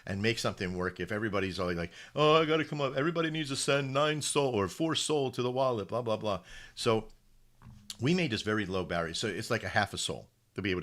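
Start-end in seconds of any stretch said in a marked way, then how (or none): 2.1-6.4 higher-pitched mimicry of money-grabbers; mock demanding crowd. Mimicking everybody who demands money to get in, mocking.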